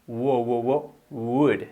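"wo, wo, wo" glides leading into "would"; W sound drill. Shown as a demonstration. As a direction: rehearse the w as "wo" before "would". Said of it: "la peculiaridad del sonido de la W".

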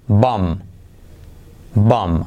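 "Bomb" dicho como "bom", sin b final; "Bomb": termina en m, la b muda; sin pronunciar la b. Shown as a demonstration. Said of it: "Omitimos la B". ("Bomb" pronunciado bom, b muda)

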